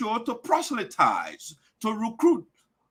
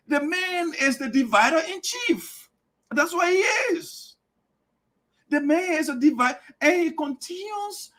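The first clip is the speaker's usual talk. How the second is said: rising outcry; 7 semitones higher than usual. Real, not acted. Denouncing rival leader.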